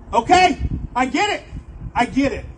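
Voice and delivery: high, loud exasperated okay; mock resignation. Young him giving in to God, loud exasperated. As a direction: throw up your hands.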